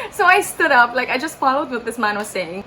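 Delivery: ordinary talk, nothing marked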